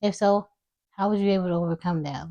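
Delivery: usual speaking voice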